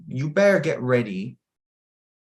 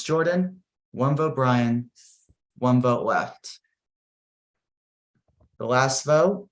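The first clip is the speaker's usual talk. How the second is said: dramatic vote read. Acted. Host reading votes to the players, suspenseful announcer. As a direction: draw out every name like a reality show host.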